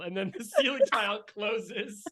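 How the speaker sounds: usual speaking voice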